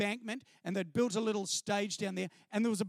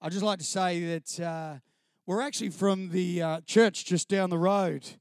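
lower, louder singer addressing the crowd; a lightly voiced singer, lower and louder into the microphone; lead singer on the mic; 5 semitones lower, 4 dB louder than usual. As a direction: play the earnest church singer at the mic. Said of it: "he said".

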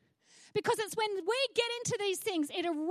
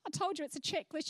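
ordinary talk

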